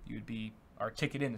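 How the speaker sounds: usual speaking voice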